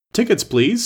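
high, sharp call of a train guard; a guard demanding tickets, pitched well up; railway guard. Acted. Guard asking passengers for tickets, high call. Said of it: "said the guard".

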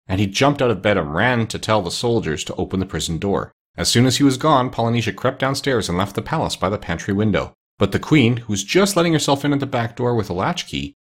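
plain reading voice

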